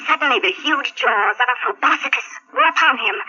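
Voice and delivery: ordinary delivery, nothing marked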